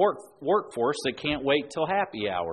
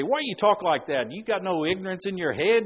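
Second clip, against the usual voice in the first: lightly imitated blunt challenge, higher-pitched; mock scolding, raised pitch; Tommy; 6 semitones higher, 4 dB louder than usual. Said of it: "there's a way that Tommy would say it".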